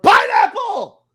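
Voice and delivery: high drawn-out yell of the word; shouted safe word; mock shout. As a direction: belt it out slowly like a chant.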